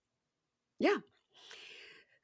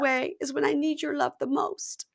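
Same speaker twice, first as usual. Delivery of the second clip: put-on higher-pitched pleading girlfriend; acted plea; 6 semitones higher than usual. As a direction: plead in a higher voice, retelling her words. Re-enacting girlfriend's plea for love.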